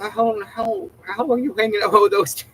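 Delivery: high, squeaky disbelief; incredulous. Retold or imitated. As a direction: quote her stunned reaction. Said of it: "She gives me a look".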